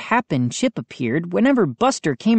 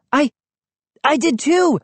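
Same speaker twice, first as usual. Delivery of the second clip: high, excited outburst; exclaiming; 10 semitones higher, 4 dB louder than usual. A narrator's reading. Brother agreeing he heard the dog, exclaiming. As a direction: blurt it out excitedly. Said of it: "I exclaimed".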